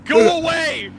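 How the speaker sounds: high, loud cry to back off; alarmed shooing. Real, not acted. Shooing something away during intro.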